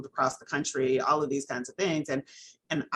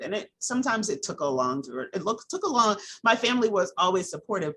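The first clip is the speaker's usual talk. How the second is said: ordinary delivery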